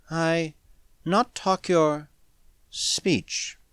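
slow, halting, soft broken speech; hesitant. A narrator's reading. Alien girl demurs to Smith in his language, hesitantly. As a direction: grope for each word, long gaps between. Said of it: "she demurred hesitantly".

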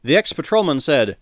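ordinary delivery

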